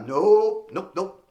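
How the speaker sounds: cocky teasing nope; teasing refusal. Retold or imitated. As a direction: dodge him playfully. Keeping the mic away.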